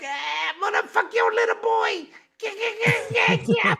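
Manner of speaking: high shouted mock rock singer. Host parodying the singer's stage banter, high mock shout. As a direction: lampoon the frontman, way up high. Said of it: "he's just coming out there like".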